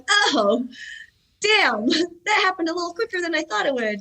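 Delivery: high, laughing mock surprise. To the hosts, laughing at how fast age hit him. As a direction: squeak it out with a laugh, caught off guard. His own surprise at aging.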